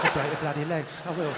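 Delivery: low London heavy; matter-of-fact London threat, pitched low; threatening London accent. Acted. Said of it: "the more threatening it becomes".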